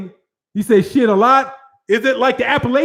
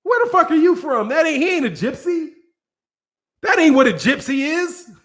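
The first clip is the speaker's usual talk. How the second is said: high, indignant disbelief; a mock offended fan, pitched up; incredulous retort; 5 semitones higher than usual. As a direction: scoff like a fed-up local fan. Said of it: "you'd be like".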